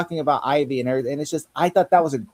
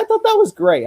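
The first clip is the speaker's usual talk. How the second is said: gushing excitement; 6 dB louder than usual. Real, not acted. Gushing over the scene.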